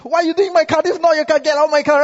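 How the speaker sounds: high, loud, outraged mock protest with a laugh; indignant car owner. Car owner ordering a stranger out, mock outrage. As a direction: play the offended owner throwing someone out.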